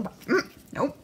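ordinary talk, nothing marked

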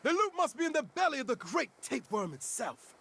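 high, grand mock-medieval proclamation; fantasy quest-giver. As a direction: announce the quest like a herald. Game master proclaims the quest.

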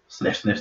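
quick whispered dog sniffs; sniffing. A narrator's reading. Sniffing sounds read aloud.

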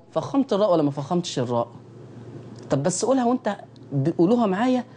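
normal talking voice

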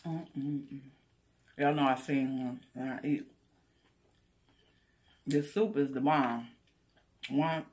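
humming with closed lips; talking while chewing. Real, not acted. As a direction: mouth full of food. Seen 1: Bites food at -3, closed lips working through the labelled words.

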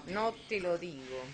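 normal talking voice

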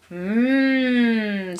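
long drawn-out hum from a troll. A narrator's reading. Troll eyeing a boy on his bike, hungry hum. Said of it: "said the troll".

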